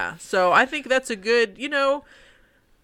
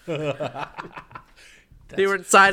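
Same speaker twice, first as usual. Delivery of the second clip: laughing; 3 semitones lower, slower than usual. Real, not acted. Joking line broken by laughter.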